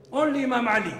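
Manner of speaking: a preacher overcome with feeling; emotional. Real, not acted. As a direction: declare it with rising fervour. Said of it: "Sorry, I get so emotional".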